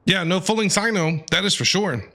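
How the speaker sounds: ordinary talk, nothing marked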